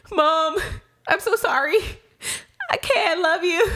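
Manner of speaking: high, halting mock-pleading voice; pleading apology. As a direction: act out a sheepish call back to mom. Acting her apologetic call back.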